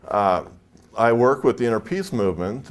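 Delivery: ordinary delivery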